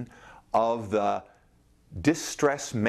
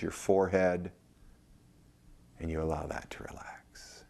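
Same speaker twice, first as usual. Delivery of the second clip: guided meditation; 5 semitones lower, 3 dB quieter than usual. Acted. Guide leads listeners to relax from feet to head, guided meditation. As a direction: guide them into relaxation, lower and softer, letting phrase ends settle.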